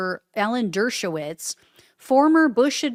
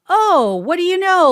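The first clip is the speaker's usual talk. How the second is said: mock astonishment, higher and louder; sarcastic surprise; 8 semitones higher, 7 dB louder than usual. Mocking the prosecutors who missed the folder, loud sarcastic surprise. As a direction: act amazed, dripping with sarcasm.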